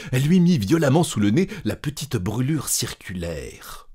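ordinary delivery, nothing marked

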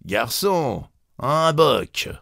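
drawn-out café summons, not full force; calling out. A narrator's reading. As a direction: call out for another drink. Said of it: "il cria de nouveau".

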